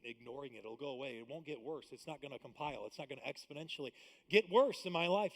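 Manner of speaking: usual speaking voice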